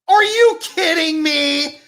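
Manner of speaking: loud, slow, high indignant yell; real frustrated shout at the screen; outraged disbelief. Real, not acted. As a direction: yell at the bad call in disbelief. Outraged at penalty call.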